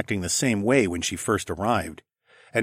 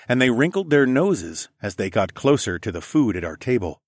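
plain reading voice

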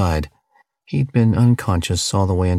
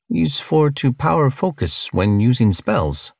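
higher-pitched alien with broken English; friendly foreign-sounding creature voice, pitched up; alien cellmate; 7 semitones higher than usual. Acted. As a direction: play an alien cellmate, higher than the narrator.

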